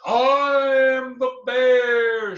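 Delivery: loud, high booming brag, drawn out slow; bragging bear. A narrator's reading. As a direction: roar it out like a proud giant bear. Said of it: "she would brag".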